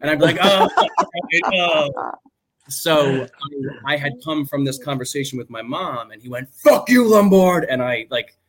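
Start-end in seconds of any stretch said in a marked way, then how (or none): 0.4-1.9 startled laugh. Retold or imitated. His startled reaction to the yell. 6.5-7.6 high, loud barked curse; yelled insult. Retold or imitated. Sergeant yelling at a late soldier, loud angry shout.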